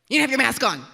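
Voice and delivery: loud, high scolding flight attendant; stern attendant. Retold or imitated. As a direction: bark it like a strict attendant.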